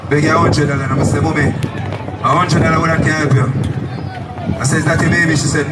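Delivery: normal talking voice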